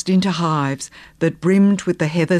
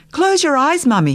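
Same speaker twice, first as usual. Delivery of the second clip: put-on high, eager child's call; much higher, childlike excitement; excited little boy; 9 semitones higher than usual. A narrator's reading. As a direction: cry it out like a small boy bursting with a surprise. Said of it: "he cried excitedly".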